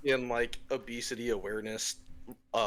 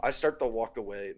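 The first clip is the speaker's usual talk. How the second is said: normal talking voice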